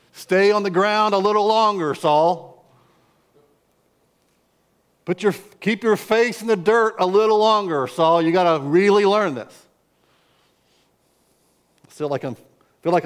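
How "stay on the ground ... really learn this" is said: God's stern voice. Retold or imitated. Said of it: "God had to then fire me".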